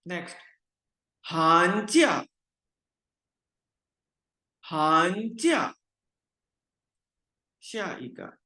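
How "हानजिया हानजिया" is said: हानजिया rising then falling; tone drill. Shown as a demonstration. Teacher modelling हानजिया for students. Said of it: "teacher drills tone word".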